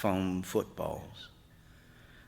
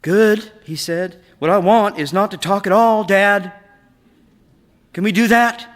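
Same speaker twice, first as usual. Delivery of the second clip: put-on young boy, much higher and louder, pleading to stop talking; boy's voice pitched up, exasperated plea; boy Eric, fed up; 10 semitones higher, 13 dB louder than usual. A narrator's reading. Eric tells Dad to stop.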